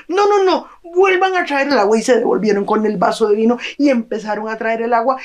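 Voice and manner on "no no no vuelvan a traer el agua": much higher, fast fretful order; fussing objection. Acted. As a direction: snap the order back. Villager calls for water again. (un vecino alterado ordena traer agua)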